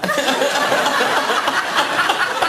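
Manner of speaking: high, long burst of laughter; real laughter breaking through the story; helpless high laugh. Real, not acted. Laughing while retelling the anecdote.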